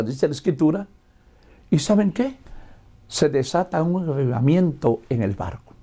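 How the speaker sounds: ordinary talk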